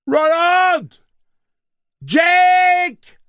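high, loud joyful bellow; overjoyed high shout; bellowing names. Acted. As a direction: call down the pit to them. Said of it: "brailla Eddie".

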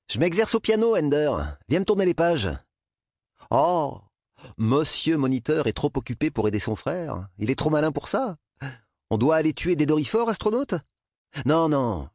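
high, sneering bully; taunting brother. A narrator's reading. Older brother orders Ender to turn his pages, taunting.

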